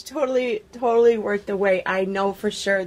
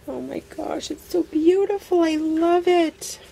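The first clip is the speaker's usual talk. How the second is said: real high-pitched gushing; gushing delight; 9 semitones higher than usual. Real, not acted. Crafter thanking her friend for beautiful flowers, gushing delight. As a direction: gush higher, drawing out the last words. Gushes over a gifted handmade flower.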